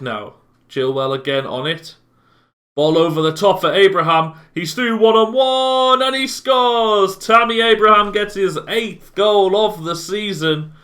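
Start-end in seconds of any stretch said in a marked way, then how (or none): 4.5-10.7 excited goal call. Real, not acted. Goal scored in commentary.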